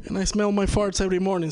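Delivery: ordinary talk, nothing marked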